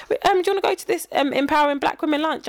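squeaky timid invitation; high-pitched imitation. Friend's invite voiced high.

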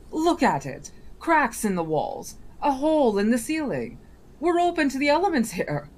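a mocking young genie, speeding up; genie boy voice. Genie boy pointing out the room.

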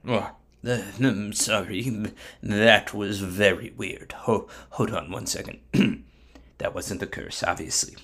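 low, soft, halting mumble; flustered, slowed-down backpedalling; embarrassed apology. Acted. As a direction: apologise sheepishly after a blunder. Bilam embarrassed by accidental blessing.

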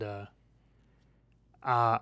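ordinary talk, nothing marked

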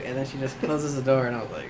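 ordinary talk

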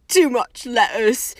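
slow, loud and glum; gloomy. A narrator's reading. Hagrid explaining the Flobberworms' death, gloomy. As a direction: give the glum verdict slowly. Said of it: "said Hagrid gloomily".

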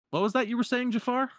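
high, cocky taunting hero. Retold or imitated. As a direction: throw it back at the villain smugly. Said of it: "Aladdin's like".

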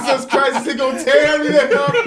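loud, high, laughing disbelief; retold alarm. Man retells fearing the big stranger, loud comic alarm. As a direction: exclaim it in comic panic.

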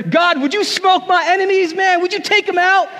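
high, loud swaggering prayer; mock swagger prayer. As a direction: pray like a cocky hothead calling down fire. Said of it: "he kinda prays with swagger".

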